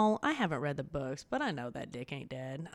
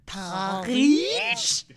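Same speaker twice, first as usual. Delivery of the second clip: put-on snooty French accent; 3 semitones higher, 10 dB louder, slower than usual. Posh French mock reply.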